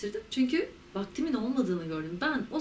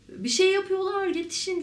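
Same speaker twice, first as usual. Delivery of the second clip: retold cry for help; 9 semitones higher than usual. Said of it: "falan diye bağırınca".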